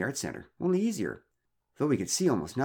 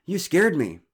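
higher, louder shaken complaint; a bit out of breath; 8 semitones higher, 9 dB louder, slower than usual. Acted. Startled man scolds neighbours for the scare. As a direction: tell them they frightened you. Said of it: "a bit out of breath".

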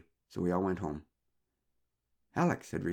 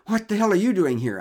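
high, loud startled demand; out of breath, startled; 11 semitones higher, 10 dB louder than usual. Acted. Startled man demands why neighbours are on the dark path. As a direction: blurt it out, still rattled. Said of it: "a bit out of breath".

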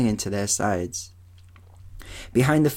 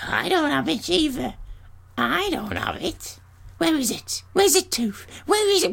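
very high crone voices, frantic and accusing; panicked old hags. Acted. Grey sisters hunt frantically for the stolen tooth in high hag voices. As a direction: ancient hags scrabbling for the lost eye.

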